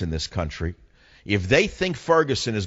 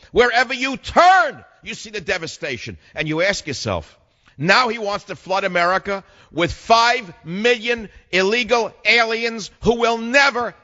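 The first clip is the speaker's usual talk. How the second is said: high, forceful political tirade; pitched-up oratory with punched key words; impassioned rant; 10 semitones higher, 4 dB louder than usual. Real, not acted. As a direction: rail at the audience in rising outrage.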